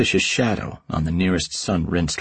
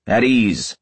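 sharp barked order, a bit louder; barked command; 4 semitones higher, 4 dB louder than usual. Acted. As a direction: bark it out like a drill sergeant. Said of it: "the shadow barks out".

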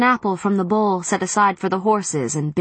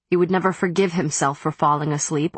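usual speaking voice

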